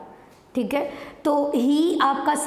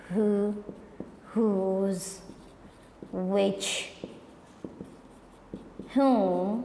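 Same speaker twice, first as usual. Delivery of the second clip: "who, whose, which, whom" as isolated words with long gaps; slow list dictation; 4 semitones lower, 6 dB quieter, much slower than usual. Shown as a demonstration. Teacher listing "who, whose, which, whom" on the board, slow dictation. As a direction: dictate "who, whose, which, whom" one word at a time, lower and unhurried.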